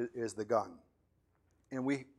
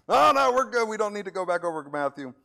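high, loud and quick "oh no, we're good"; a hammed-up congregant dodging the lesson; mock hasty denial; 6 semitones higher, 7 dB louder than usual. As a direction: brush it off in a rush. Congregation pretending to remember.

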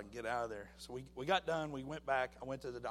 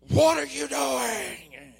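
drawn-out, very loud, high yell; the doctor yelling at the patient over the test results; doctor screaming; 11 semitones higher, 18 dB louder, much slower than usual. Retold or imitated. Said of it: "And he's screaming at me".

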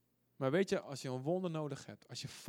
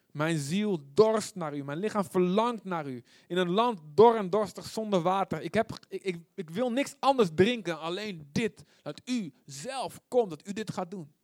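loud, raised, insistent psalm cry; fervent thirsting plea; 4 semitones higher, 8 dB louder than usual. Acted. Preacher pleading as thirsty soul to God, fervent. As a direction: plead forcefully, leaning on key words.

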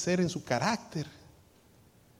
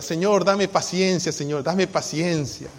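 mock prayer; 4 semitones higher, 5 dB louder, slower than usual. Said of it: "Y usted ora".

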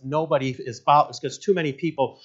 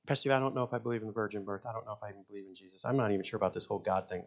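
fast, softer, rushed voice of a doubter; doubting congregant; 4 semitones lower, 10 dB quieter, faster than usual. Retold or imitated. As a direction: rattle off the doubts quickly. Pastor voicing doubting congregant.

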